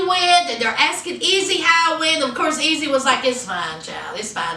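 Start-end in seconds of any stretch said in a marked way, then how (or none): 3.2-4.6 low, soft, dismissive mumble; sullen brush-off. Retold or imitated. Teen asked about her day at dinner, sullen low mumble. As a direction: brush the question off, barely bothering.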